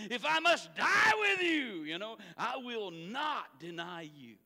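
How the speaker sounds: high, loud, slowed shout of loyalty; emphatic loud vow. Acted. Preacher voicing Peter swearing he'll die for Jesus, loud. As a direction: belt it out like a man swearing his life. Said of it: "he gets louder".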